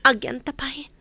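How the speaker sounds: hushed conspiratorial whisper; secretive whisper. Acted. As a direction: whisper it so no one overhears. Secret plan, speaking low.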